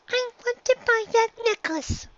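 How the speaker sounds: put-on a young filly asking earnestly; little filly voice. A narrator's reading.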